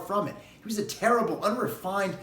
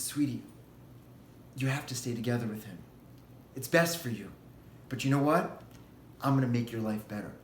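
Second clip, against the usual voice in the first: tender father; 6 semitones lower, 6 dB quieter than usual. Acted. King gently answers his daughter.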